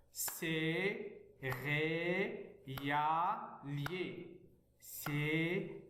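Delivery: céréalier chanted out as cé, ré, a, lier; céréalier with each syllable held apart. Shown as a demonstration. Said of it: "lire les mots syllabiquement".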